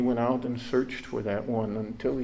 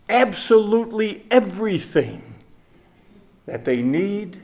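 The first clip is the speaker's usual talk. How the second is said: fervent preaching; 8 semitones higher, 5 dB louder than usual. Real, not acted. Preacher building to climax.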